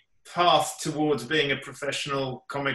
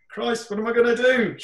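high, louder exclamation of dismay; mock panic; 11 semitones higher, 6 dB louder than usual. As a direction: act out a panicked thought. Retold panicked thought to himself.

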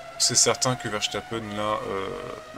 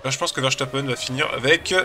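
ordinary delivery

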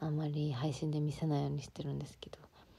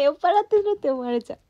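laughing protest; 10 semitones higher, 10 dB louder than usual. Real, not acted. Laughing protest about seeming drunk.

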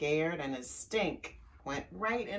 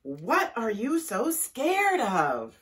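loud slow question; 4 semitones higher, 7 dB louder, slower than usual. A narrator's reading. Bat questions fleeing animals.

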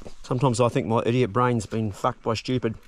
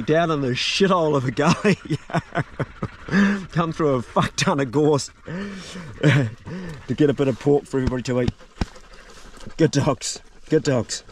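usual speaking voice

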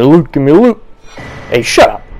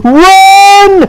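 shouted run; 6 dB louder than usual. Real, not acted. Fleeing in game.

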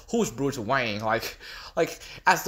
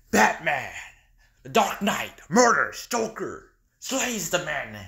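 normal talking voice

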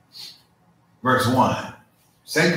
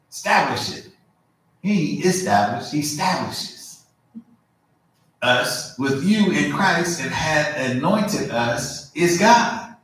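raised, measured preaching read; scripture reading; 4 semitones higher, slower than usual. Acted. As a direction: proclaim the verses to the congregation. Reads scripture aloud.

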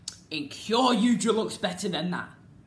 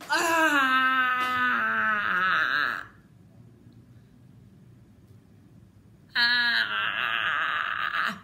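frustrated scream. Real, not acted. Fan crying out in frustration at the robot suits. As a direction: wail at the screen.

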